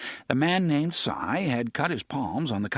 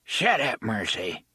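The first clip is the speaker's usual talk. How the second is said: put-on thick rural accent. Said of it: "spoke with a thick accent".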